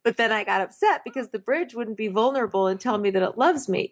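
high, gushing confession; hammed-up earnest declaration of love; mock lovesick woman. Imitating the woman's flighty confession.

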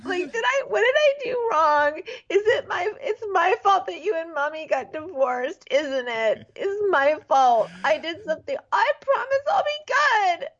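high, whiny, fake-sobbing girl voice; mock crying like a little girl. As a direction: blubber it like a heartbroken child. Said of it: "she starts crying like a little girl".

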